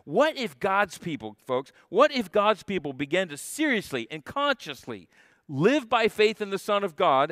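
ordinary delivery

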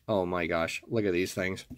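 ordinary delivery

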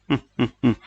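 short, chuckled run of ha-has; acted character laugh. A narrator's reading. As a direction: narrator voices a character's brief laugh. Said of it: "เขาหัวเราะลั่นครู่หนึ่ง". (หัวเราะเป็นจังหวะสั้นๆ แบบแสดง)

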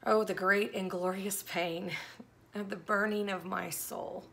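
earnest devotional poetry reading; dramatic poem reading. Acted. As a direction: read it like a heartfelt lament.

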